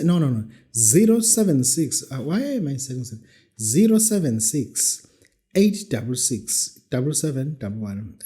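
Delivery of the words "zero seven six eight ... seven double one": slow and clear digits; slow school dictation. Shown as a demonstration. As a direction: dictate carefully to listeners. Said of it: "Let me repeat the number".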